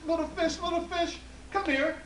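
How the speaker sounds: high, loud sing-song call; high calling voice to the water. Acted. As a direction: call the fish from the shore, pitched high. Old woman calling the fish.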